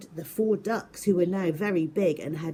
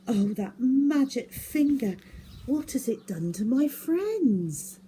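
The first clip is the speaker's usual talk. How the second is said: dismayed; 7 semitones higher, 3 dB louder than usual. Acted. Dismayed exclamation.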